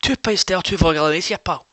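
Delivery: exasperated Glasgow punter repeating order; Glaswegian accent. Retold or imitated. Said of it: "I goes".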